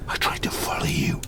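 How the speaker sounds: quick urgent whisper; fast whisper. Acted. Creature reproaching his creator, hurried whisper. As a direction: rush it out under breath.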